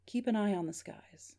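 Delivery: restrained, faint caller voice; weakening voice. Acted. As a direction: say it evenly, as if fading down the line. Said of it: "but still kind of weakening out".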